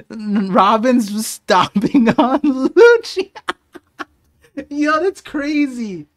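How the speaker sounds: slow, loud, laughing through the words; delighted laugh mid-sentence. Real, not acted. Laughing at Robin stomping Lucci, loud and slow.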